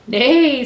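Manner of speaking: high, loud imitated neigh; horse neigh. A narrator's reading. Horse says hello to Blue, loud neigh. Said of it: "said the horse".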